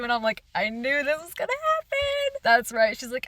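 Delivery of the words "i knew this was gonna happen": excited outburst. Retold or imitated. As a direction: squeal it high, thrilled to be right. Reacting excitedly to predicted story twist.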